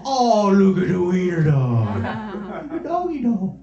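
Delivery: put-on high, slow, loud baby talk to a dog; doting dog-owner voice; cooing at a puppy. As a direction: coo at the wiener dog.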